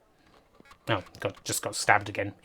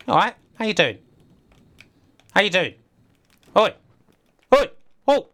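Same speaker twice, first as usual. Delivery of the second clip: calling out; 8 semitones higher, 6 dB louder, faster than usual. Pretend. Greeting a mermaid in game.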